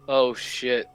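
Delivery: ordinary delivery, nothing marked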